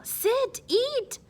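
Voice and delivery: high motherly voice; high, brisk Greek mother. Acted. Ma ordering Spyro to eat, high and brisk.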